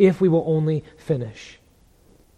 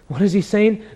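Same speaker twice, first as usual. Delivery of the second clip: normal talking voice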